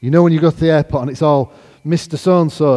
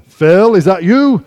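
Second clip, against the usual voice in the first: loud, high, stretched Texan call; imitated booming Texan greeting; larger-than-life Texan; 7 semitones higher, 10 dB louder, slower than usual. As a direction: holler a drawn-out question like a big Texan. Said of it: "larger than life Texan".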